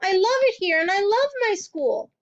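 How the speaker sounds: high, loud pleading protest; crying protest. A narrator's reading. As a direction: beg to stay. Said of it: "Matilda cried".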